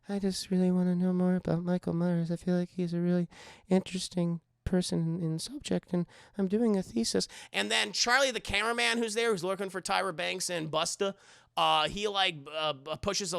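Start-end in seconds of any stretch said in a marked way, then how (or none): 0.1-7.3 lightly voiced earnest student. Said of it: "and she's like".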